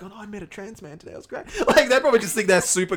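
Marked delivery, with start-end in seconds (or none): 0.0-1.5 soft, fast delighted murmur; mock stranger voice. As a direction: gush quietly and quickly. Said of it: "they're just going back".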